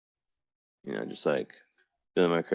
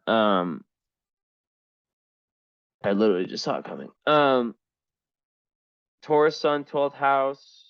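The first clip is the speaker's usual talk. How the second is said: usual speaking voice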